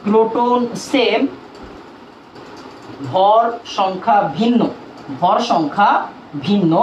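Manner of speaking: slow, word by word, as if writing on the board; slow dictation. Shown as a demonstration. Teacher dictating to students, slow and deliberate. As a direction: spell it out slowly for the class to copy. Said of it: "আমি লিখতেই পারি".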